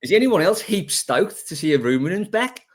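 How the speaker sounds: hammed-up Australian twang, high and loud; bad Australian accent, big and bright. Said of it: "That was an Australian accent".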